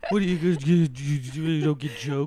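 put-on jeering voice; mocking. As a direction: imitate a sputtering heckler. Mocking those who say it.

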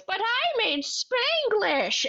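high, slow crazy Sandler voice. Acted. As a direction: wail it like a madman. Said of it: "in that crazy voice".

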